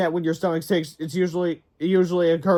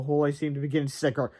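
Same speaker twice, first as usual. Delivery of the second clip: usual speaking voice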